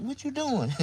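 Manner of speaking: high mock female voice; light, high-pitched imitation; woman's voice.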